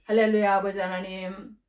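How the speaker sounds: prayer. Real, not acted. Preacher leading the congregation in prayer.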